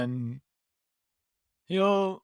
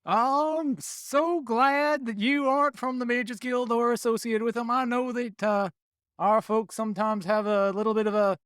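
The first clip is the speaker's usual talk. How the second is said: friendly Southern druid, pitched up and slowed at first; 6 semitones higher than usual. Acted. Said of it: "switch to speaking in Druidic".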